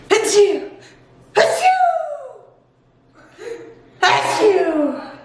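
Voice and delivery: sneezes. Real, not acted. Sneezing mid vlog.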